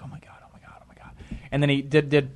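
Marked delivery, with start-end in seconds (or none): 0.0-1.1 barely audible repeated whisper; anxious whisper. Retold or imitated. Friends watching him on stage, retold nervous whispering. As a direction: whisper it under your breath as if dreading disaster. Friends dreading he says something stupid.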